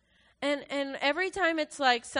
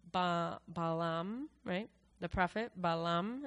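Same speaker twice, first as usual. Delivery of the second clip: Balaam said with a rising, questioning tune; unsure pronunciation; 4 semitones lower, 3 dB quieter than usual. Real, not acted. Speaker checking a prophet's name with listeners, unsure. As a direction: try the name Balaam tentatively, lifting it at the end. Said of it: "is that how you say it?".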